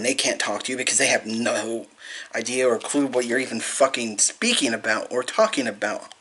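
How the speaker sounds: ordinary talk, nothing marked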